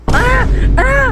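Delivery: very high, loud mock sobs; full-blown fake sobbing. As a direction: ham up the toddler's fake crying. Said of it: "full blown".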